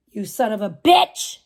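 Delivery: ordinary talk, nothing marked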